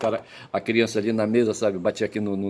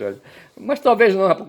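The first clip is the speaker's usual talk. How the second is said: ordinary talk, nothing marked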